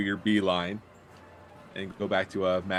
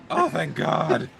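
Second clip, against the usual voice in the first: relieved sigh of thanks; 6 semitones higher, 4 dB louder, slower than usual. Acted. NPC relieved at break time.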